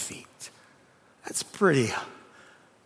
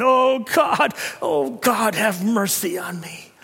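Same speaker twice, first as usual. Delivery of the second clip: crying out; 9 semitones higher, 8 dB louder, slower than usual. Acted. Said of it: "he's crying out".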